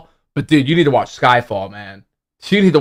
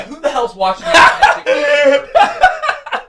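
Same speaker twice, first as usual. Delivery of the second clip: laughing incredulous shout; 11 semitones higher than usual. Real, not acted. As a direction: burst out laughing while asking. Laughing disbelief at a donation.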